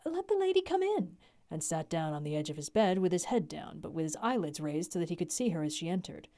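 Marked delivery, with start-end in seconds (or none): 0.0-1.2 higher, cheerful madman. Acted. Renfield welcomes the lady, high and cheerful. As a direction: sing it out brightly. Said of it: "he said cheerfully".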